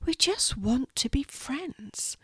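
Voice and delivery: high, soft and slow tiny voice; small forlorn creature, drawn out; wistful spider. Acted. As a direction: plead softly as a little spider. Spider longs for friendship.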